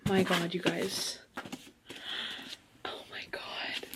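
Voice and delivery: slow, hushed awe fading into a whisper; overwhelmed whisper. Real, not acted. Reader tells viewers about her new book, awestruck whisper. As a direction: let the voice vanish in disbelief.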